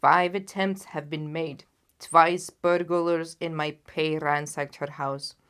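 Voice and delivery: a proud monarch, loud and grave; booming royal client; loud, heavy king. Acted. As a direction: lay out the failures like a vexed king. The king laments his failed attempts.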